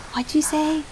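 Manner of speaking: soft character voice. Acted. Character voice in visual novel.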